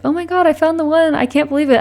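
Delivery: excited disbelief. Retold or imitated. Retelling her own shocked reaction.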